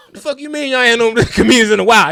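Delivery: loud, high-pitched indignant comeback; indignant retort. Retold or imitated. Retorts to the usher, offended.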